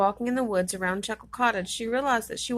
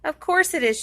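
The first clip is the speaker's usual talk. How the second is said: giggling; 7 semitones higher, 5 dB louder than usual. A narrator's reading. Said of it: "she giggled".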